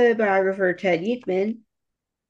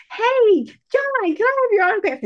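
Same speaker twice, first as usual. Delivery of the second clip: much higher and louder, gushing; starstruck fan; 5 dB louder than usual. Pretend. Fan gushing at John Wilkes Booth to distract him, high and loud. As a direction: squeal at him like an eager groupie. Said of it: "Or I could have been like".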